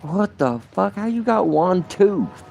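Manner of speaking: stunned at what the photo shows; shocked disbelief. Retold or imitated. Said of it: "I'm like".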